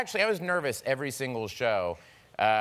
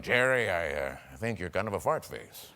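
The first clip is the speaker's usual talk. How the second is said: put-on grizzled old businessman; 6 semitones lower than usual. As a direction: play it as a grizzled, earnest old man. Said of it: "grizzled, kind of old businessmen".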